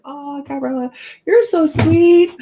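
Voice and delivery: high, slow, gushing sweet voice; sweet oracle cards. Retold or imitated. Sweet oracle cards cooing at a viewer, high and slow. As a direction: coo it like a gentle card deck. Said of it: "They're like".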